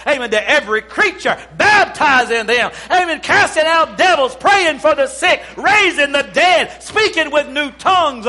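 rally the church to rise up; full-force preaching. Acted.

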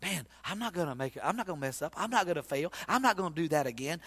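a churchgoer quietly vowing to do better; sincere vow. Retold or imitated. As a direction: murmur it like a heartfelt promise. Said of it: "people are sincere when they say".

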